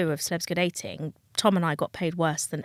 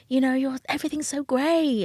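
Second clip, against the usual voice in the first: higher-pitched mock gushing; put-on starstruck enthusiasm; imitated gushing admirers; 6 semitones higher than usual. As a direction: gush like an impressed passer-by, pitched up. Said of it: "walking outside your house and everyone going".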